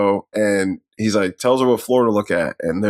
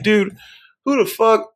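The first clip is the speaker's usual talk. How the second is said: higher-pitched, retold disbelief; mock incredulous; 10 semitones higher than usual. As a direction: act out a baffled comeback, pitched up. Retold baffled reply, pitch jumps.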